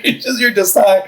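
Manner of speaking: calm yourself down but crack up; giggling through the reassurance; laughing self-talk. Retold or imitated. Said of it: "I'm just like".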